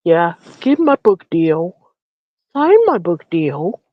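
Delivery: slow mock impression. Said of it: "he was so slow and unanimate, like".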